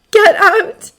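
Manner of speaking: high, loud scream; anguished high shriek. A narrator's reading. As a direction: scream it out in distress. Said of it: "she screams into his chest".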